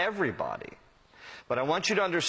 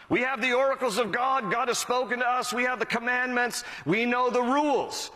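higher-pitched, self-satisfied boast; proud boasting; 6 semitones higher than usual. Acted. Pastor voicing the over-righteous bragging of the law, proud boast. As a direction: play the over-righteous, reeling off their privileges. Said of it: "they pride themselves on having the law of God".

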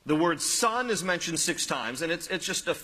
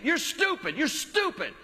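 put-on high, bratty child's yell; whiny kid screaming at his mom in the aisle; 8 semitones higher than usual. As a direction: mimic a shrieking child insulting his mom. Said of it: "he just kept saying it".

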